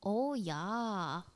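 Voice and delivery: slow put-on South Dakota accent; rounded long vowels, mock South Dakota. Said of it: "I'm from South Dakota".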